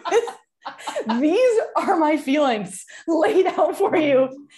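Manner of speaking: high, loud, drawn-out declaration through laughter; slow, loud and high, laughing as you go; laughing mock cat speech. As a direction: play the cat's grand confession, cracking up. Voicing cat's feelings, laughing.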